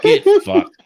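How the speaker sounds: high, loud disgusted cry; exasperated yell at the mess; disgusted outburst. Retold or imitated. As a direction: blurt it out in disbelief. Reacting to duck mess.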